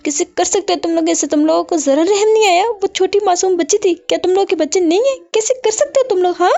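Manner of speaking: high, desperate crying accusation; screaming in anguish. A narrator's reading. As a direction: scream at them, shattered mother. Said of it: "चीखते हुए बोलती". (चीखती हुई रोती माँ)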